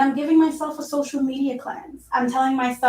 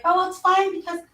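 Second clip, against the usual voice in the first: carefree past self; 7 semitones higher than usual. Retold or imitated. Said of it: "and I'd be like".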